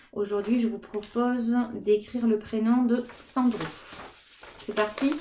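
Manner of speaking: ordinary talk, nothing marked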